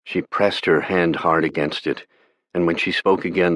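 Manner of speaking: usual speaking voice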